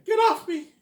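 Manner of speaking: imitating Brad, high squeal; Brad impression. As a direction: squeal through a laugh. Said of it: "I'm saying".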